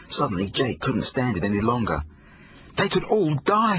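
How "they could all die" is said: put-on boy yelling at his parents in desperation; desperate yell. A narrator's reading. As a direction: yell it out in despair. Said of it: "he yelled".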